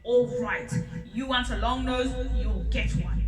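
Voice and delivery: loud cross king. Acted. Lion still cross, granting Elephant a long nose. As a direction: snap back at him, fed up. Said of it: "Lion, still cross".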